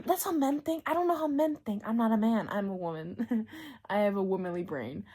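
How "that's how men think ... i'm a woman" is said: ordinary talk, nothing marked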